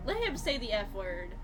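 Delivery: ordinary talk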